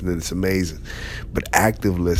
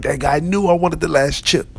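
high, louder whiny complaint; frustrated shopper; 9 semitones higher, 4 dB louder than usual. Retold or imitated. Mock-frustrated shopper griping to a listening leader. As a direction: whine like someone wronged over chips.

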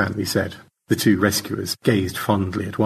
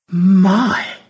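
a high loud little exclamation; mock surprise; 9 semitones higher, 8 dB louder than usual. Margaret reacts to the tale, mock surprised. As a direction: feign being impressed.

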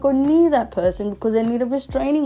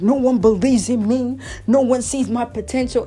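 louder, self-pitying whine; mock dramatic self-pity; 4 semitones higher, 5 dB louder than usual. Imitating herself being dramatic, mock self-pity. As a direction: play the misunderstood star. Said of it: "I'm like".

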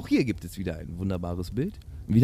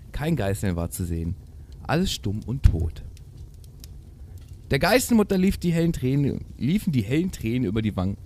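theatrical fairy-tale narration; Märchenlesung; 6 semitones higher than usual. A narrator's reading. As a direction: read it like a dramatic storybook. Reads the tale aloud. (theatralisch vorgelesenes Märchen)

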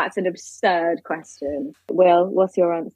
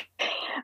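silent breathy laugh; 13 dB quieter than usual. Real, not acted. Guest laughs at teasing.

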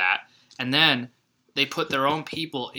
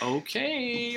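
mock okay, much higher; 11 semitones higher, 4 dB quieter than usual. Quoting reaction.

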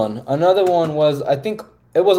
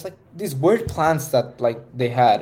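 ordinary talk, nothing marked

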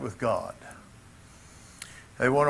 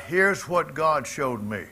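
ordinary talk

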